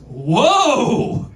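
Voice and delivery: jolly mock Santa exclaim; a jolly Santa, slowed and hammed up. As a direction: play a booming department store Santa. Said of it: "Santa looks at me and goes".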